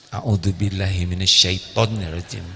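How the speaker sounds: low, slow, hushed prayer; slow recitation. Shown as a demonstration. Preacher demonstrating the slow recitation, low. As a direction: murmur it slowly. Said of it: "Pelan ya".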